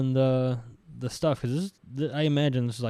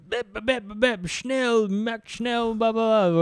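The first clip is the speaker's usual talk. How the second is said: high-pitched mock-German gibberish orders; mock German officer; 9 semitones higher, slower than usual. German officer instructing new recruit, mock German gibberish. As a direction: rattle off fake German like a naval officer. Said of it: "naval officer telling his new guy".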